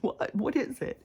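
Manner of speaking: startled high "What is it?"; quick alarmed checking on the whining dog; jumpy anxious self. Retold or imitated. Jumping up at every peep from the dog, startled.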